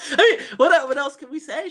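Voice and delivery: high-pitched words through laughter; laughing. Real, not acted. Brushing off the liar jibe, laughing. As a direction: talk while getting over a big laugh.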